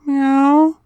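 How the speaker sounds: high loud mock meow; a playful imitated cat meow. Cat meows at the fish.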